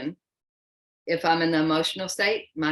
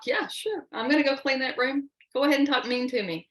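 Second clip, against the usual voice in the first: much higher, mocking kid's voice; hammed-up sarcastic teenager; sarcastic kids; 8 semitones higher than usual. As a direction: sneer back like a defiant child. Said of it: "they're like".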